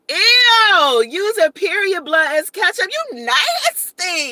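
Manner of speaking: high, loud, stretched-out disgust. Real, not acted. Vlogger recoiling at a gross chat comment, loud disgust.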